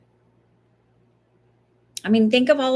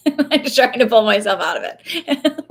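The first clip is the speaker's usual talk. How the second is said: laughing; 3 semitones higher, 5 dB louder, slower than usual. Real, not acted. Laughs while admitting self-criticism.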